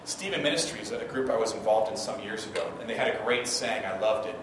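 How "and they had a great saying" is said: ordinary delivery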